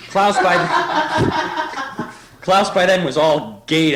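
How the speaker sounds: slow words squeezed through laughter; giggling through the line; laughing. Real, not acted. Reader laughing too hard to read, giggly.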